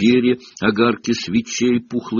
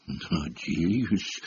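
trembling; 4 semitones lower, 11 dB quieter than usual. A narrator's reading. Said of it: "дрожащим".

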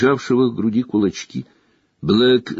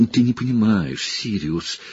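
whimpering. Acted. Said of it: "проскулил Петтигрю".

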